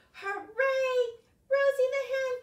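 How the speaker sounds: high, loud cheer. Acted. Teacher cheering as the hen lays her egg. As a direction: celebrate it out loud.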